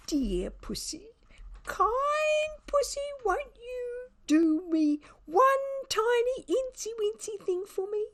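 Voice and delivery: a cloying high begging voice; wheedling beg. A narrator's reading. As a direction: wheedle like a grovelling father. Father wheedling the cat, mock-begging.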